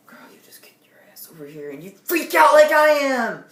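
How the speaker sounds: hushed start bursting into a high, loud yell; whisper building to yell. Retold or imitated. Teen retelling reply to a friend, whisper exploding into a yell. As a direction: start under the breath, then explode. Retold freak-out at calming friend.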